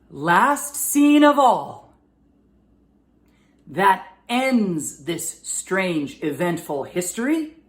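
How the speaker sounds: slow, projected line for repeating; slow model reading. Shown as a demonstration. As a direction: stretch out each phrase for the class to echo. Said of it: "repeat after me".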